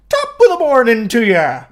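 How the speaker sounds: a loud cheery fake Irish greeting; mock Irish accent. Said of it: "answering the phone with an accent".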